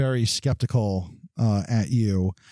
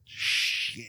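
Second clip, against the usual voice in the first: muttered curse; 6 dB quieter than usual. Acted. Cletus curses, kicks something.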